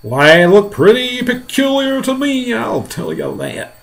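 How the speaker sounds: slow, loud character voice starting high; onlooker's voice. Acted. Man with binoculars reporting on the strangers, slow character voice. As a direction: start high and loud, then drop, slowly.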